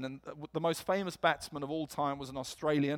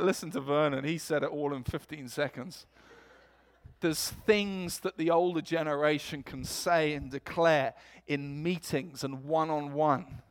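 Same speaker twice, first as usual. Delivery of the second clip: normal talking voice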